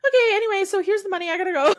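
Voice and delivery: high, loud, rushed fluster; mock giddy high voice; flustered flirt. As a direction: blurt it like a nervous admirer caught out. Acting out flustered flirt scene.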